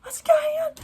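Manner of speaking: panicked shriek pitched very high; mock panic. Vlogger acting the characters panicking at the gunshots, shrieking. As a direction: scream it like the characters in terror. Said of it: "And they're like".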